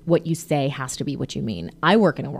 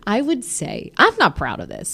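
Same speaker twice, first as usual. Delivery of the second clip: ordinary delivery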